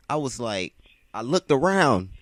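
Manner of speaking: plain reading voice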